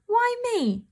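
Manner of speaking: mewed high, like a kitten; meowing. Acted. The cat pleads as Mother pulls her, a high mew. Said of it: "I meowed".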